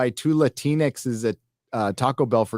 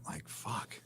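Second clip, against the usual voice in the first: whispered curse; 6 semitones lower, 19 dB quieter than usual. Real, not acted. Muttered curse.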